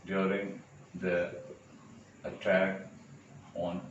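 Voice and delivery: slow, with long gaps between words; word-by-word dictation. Shown as a demonstration. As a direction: dictate it piece by piece as if writing it out. Spelling out an example while writing.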